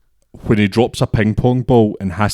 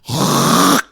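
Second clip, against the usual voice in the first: hawking up phlegm; 4 semitones higher than usual. Retold or imitated. Retelling a girl spitting on him, imitated hawking noise. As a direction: imitate gathering phlegm to spit.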